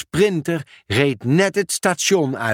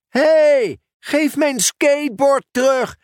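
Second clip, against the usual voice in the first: indignant shout; 11 semitones higher than usual. Acted. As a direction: yell for the board back. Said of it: "riep Dick". (luid verontwaardigd roepen)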